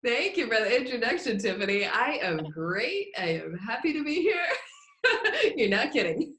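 giggly, soft and smiling; laughing. Real, not acted. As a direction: talk through happy laughter. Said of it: "You hear the smile in your voice".

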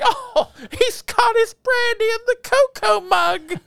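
high, slow mocking lady voice; squeaky amused old lady; imitating Miss Plimsoll. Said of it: "was like".

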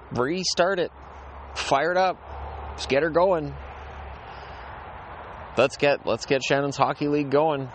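high, eager rallying call; excited. Real, not acted. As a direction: fire yourself up about restarting. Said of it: "excited about restarting his league".

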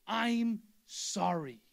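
"I'm sorry" stretched out slowly, each word weighted; preacher showing the congregation the right words, slow and deliberate; slow emphatic. Shown as a demonstration. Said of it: "Not sorry".

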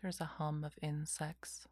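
whispered guided meditation; slow calming whisper, lots of pauses; soft spa whisper. Acted.

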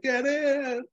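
high, urgent acted-out call; mock urgent call. Marty hurrying them into the car, high mock urgent call.

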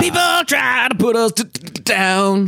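[name]. Retold or imitated. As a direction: stammer it out like the [name] singer. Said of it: "[name] talking about my generation impression".

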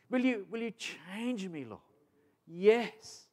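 voicing a believer's questions and God's yes; acted prayer dialogue. Call-and-response dialogue with God.

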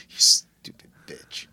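slow, whispered mock insult; mock emotional outburst. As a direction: whisper it with exaggerated contempt. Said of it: "Sorry, I'm emotional right now".

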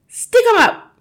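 very high, loud challenge; yell. A narrator's reading. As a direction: yell it as you burst in. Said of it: "she yelled".

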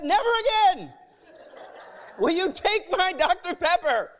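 high, loud mock-dramatic outburst; hammed-up vow of a wronged little brother; defiant vow. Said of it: "and I said".

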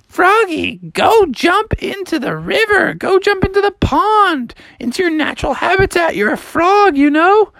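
high-pitched bossy mother voice; exasperated mom. Acted. Mom sending Froggy to the pond, high and exasperated. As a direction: order him off in a high mom voice.

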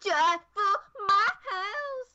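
high, slow, warbly pop-singer take. Acted. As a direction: stretch it high and wailing.

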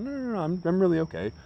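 high, insistent reassurance; reassuring. Retold or imitated. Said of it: "I'm like".

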